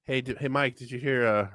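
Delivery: usual speaking voice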